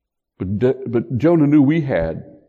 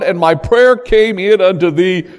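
raised, fervent reading of the psalm; scripture reading; 7 semitones higher, 8 dB louder than usual. Acted. Preacher reading Jonah's prayer to the congregation, raised and fervent. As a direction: read it out like a prayer from the pulpit.

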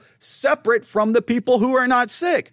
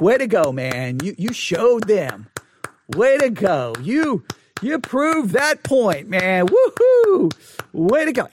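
slow sarcastic praise for the arrested man; sarcastic cheering; slower than usual. Retold or imitated. Applauding the handcuffed man, mock cheering. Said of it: "stood up and clapped".

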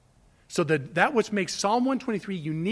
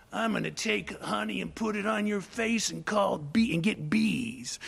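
put-on taunting kid; 4 dB quieter than usual. Childish taunt, twelve-year-old.

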